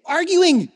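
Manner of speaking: ARGUING hit loud and high; emphatic correction. Real, not acted. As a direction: punch ARGUING with a high, loud start that falls. Stressing arguing over just thinking.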